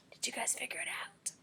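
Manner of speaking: soft breathy whisper. Real, not acted. Teacher whispers to the class about the riddle. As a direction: hush it like sharing a secret.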